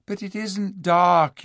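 high, slow, earnest insistence; pleading. A narrator's reading. Lucy to the dwarfs, pleading. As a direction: plead for them to understand.

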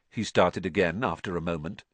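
ordinary delivery, nothing marked

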